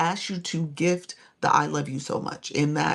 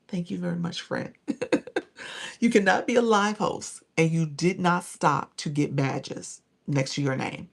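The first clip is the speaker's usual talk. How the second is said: ordinary delivery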